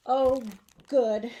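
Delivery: a loud, bright burst of relief; relieved exclamation. Acted. Mom relieved her daughter feels ready, loud relieved burst. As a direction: blurt it out, glad.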